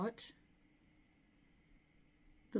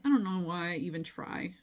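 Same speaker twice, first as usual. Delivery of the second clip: ordinary talk, nothing marked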